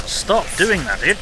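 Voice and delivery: loud, high frustrated shout; exasperated yell. Real, not acted. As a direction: yell at the game in protest. Yelling at an enemy.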